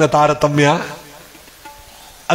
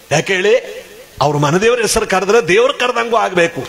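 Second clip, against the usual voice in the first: ordinary talk, nothing marked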